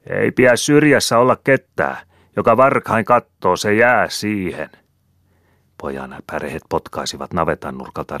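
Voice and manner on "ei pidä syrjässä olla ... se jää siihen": much higher, loud commanding shout; loud, high sorcerer's command. A narrator's reading. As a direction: call out to the whole yard full of villagers. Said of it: "ja huusi".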